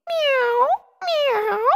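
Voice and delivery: high meowing; cat meow. A narrator's reading. Pickles greeting the frog, meowing. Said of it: "meowed Pickles with a purr".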